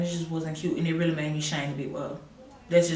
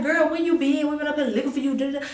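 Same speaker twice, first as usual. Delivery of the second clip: excited relief; 8 semitones higher, 5 dB louder, faster than usual. Retold or imitated. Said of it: "we like".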